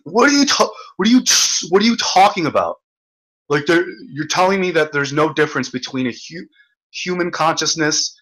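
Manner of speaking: high, outraged disbelief; incredulous guests. Retold or imitated. Roommate's friends reacting to his worm theory, high and incredulous. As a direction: sputter it like you can't believe him. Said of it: "they go completely in".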